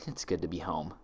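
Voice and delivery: soft and relieved; content. A narrator's reading. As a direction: ease into it gently. Content relief after laughing.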